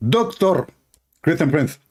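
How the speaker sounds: high, strained mock preacher voice; mocking caricature of a rival debater; Zakir Naik. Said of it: "Uh, Zakir Naik".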